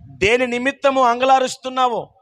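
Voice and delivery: ordinary talk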